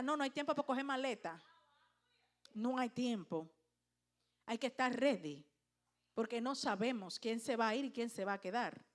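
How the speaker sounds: usual speaking voice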